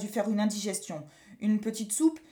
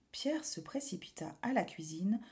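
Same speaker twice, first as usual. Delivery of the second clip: usual speaking voice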